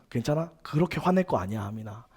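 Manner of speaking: soft, low-key calming of a friend; soothing friend. Retold or imitated. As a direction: gently talk a friend down, trailing very quiet. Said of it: "다독여주고".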